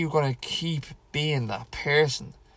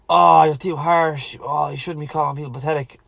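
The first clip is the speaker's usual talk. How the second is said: mock offended listener; 3 semitones higher, 6 dB louder than usual. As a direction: imitate a touchy critic, hammed-up. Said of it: "some people might hear that ... and go".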